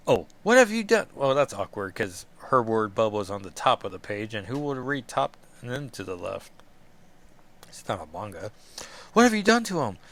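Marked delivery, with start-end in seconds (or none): 0.4-1.1 alarmed demand. Acted. Illyana voiced, first try. 9.1-10.0 alarmed demand. Acted. Reread after bubble order.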